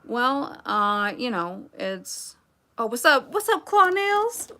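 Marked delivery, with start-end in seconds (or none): 2.8-4.5 excited greeting. Real, not acted. Greeting a viewer in chat.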